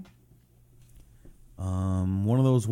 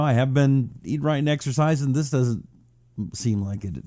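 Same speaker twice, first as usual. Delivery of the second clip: ordinary delivery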